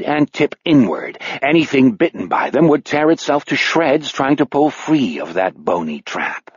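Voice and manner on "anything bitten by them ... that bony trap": ordinary delivery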